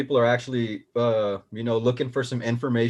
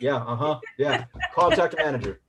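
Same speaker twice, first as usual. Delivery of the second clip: higher, brighter mock phone voice; imitating phone call; 5 semitones higher than usual. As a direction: act out the call. Mimics phone call, rising pitch.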